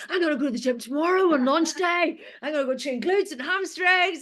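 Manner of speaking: high, eager declaration; excited. Retold or imitated. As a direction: announce your gym plan, buzzing with excitement. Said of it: "you just get so excited".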